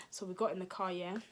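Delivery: ordinary talk, nothing marked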